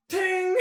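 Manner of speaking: high, ringing "ting"; ping it out like struck metal; imitated hammer clang. Game master imitates the smith's hammer, high clang.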